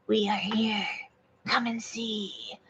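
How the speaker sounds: hushed, slow otherworldly alien voice; alien being. Acted. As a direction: beckon softly and slowly as an alien. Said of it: "the being said".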